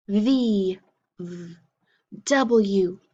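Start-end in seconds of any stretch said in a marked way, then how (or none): 1.2-1.6 just the V buzz; the sound of V. Shown as a demonstration. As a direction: say "V" as a quiet buzzing vvv. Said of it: "say the sound it makes".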